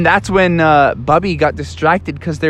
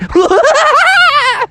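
high loud villain laugh; 5 dB louder than usual. Acted. Insane Bear laughs.

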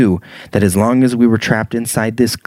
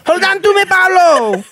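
high, loud hammed-up cry; cling on with hammy desperation; melodramatic plea; 3 dB louder than usual.

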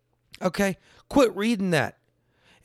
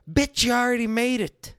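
usual speaking voice

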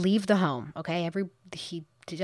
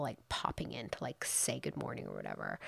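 lower, soft whispered aside; mock whisper; 6 semitones lower, 10 dB quieter than usual. Host mocking his excuse to viewers, whispered. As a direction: whisper it with a sneer.

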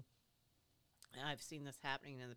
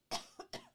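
dry, breathy cough; real cough breaking off speech; coughing fit; 3 dB louder than usual. Real, not acted. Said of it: "Sorry to be coughing in your ear here".

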